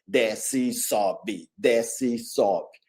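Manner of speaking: very slow, lilting "desce e sobe"; slow singsong. Shown as a demonstration. Teacher sings the falling-rising rhythm for students, slow and lilting. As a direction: draw it out like a little dance chant. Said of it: "É uma dancinha".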